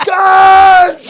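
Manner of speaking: put-on the old man's loud yell walking out; shouted greeting. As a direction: bellow it across the pub. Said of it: "he just went".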